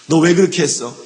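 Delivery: loud, demanding voice of God; God's stern question. Retold or imitated. God confronting a person about their sin, loud stern demand. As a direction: call the sinner to account.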